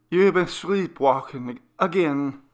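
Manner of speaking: a mother calming her frightened son; reassuring mother. Acted. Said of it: "she tried to reassure me".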